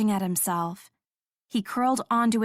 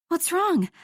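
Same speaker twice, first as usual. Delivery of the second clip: high, urgent panicked question; alarmed at his gasp; higher, anxious question; 8 semitones higher than usual. Acted.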